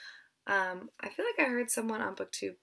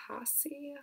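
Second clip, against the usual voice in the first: whispered; 7 semitones higher, 7 dB quieter than usual. Shown as a demonstration. Second try at the name.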